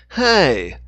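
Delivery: loud sharp shout; yelled. Acted. Dixon yells at Hannah after she smashes the tablet. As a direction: shout to stop her. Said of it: "Dixon yelled".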